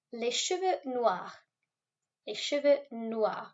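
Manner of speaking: Les cheveux noirs said slowly, noirs as nwar with the wa sound; slow French model. Shown as a demonstration. Teacher drilling pupils on the wa sound, slow. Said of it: "O and I in French make a wa sound".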